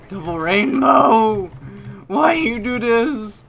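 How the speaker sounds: high, loud, slow hammed-up wail; mock outcry. Gamer hamming up a wail after dying. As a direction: stretch it out like a parody meltdown.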